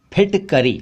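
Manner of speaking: फिटकरी alone, as "p-hitkari"; aspirated ph. Shown as a demonstration. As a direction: puff the start of फिटकरी. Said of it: "फिटकरी बोला करें".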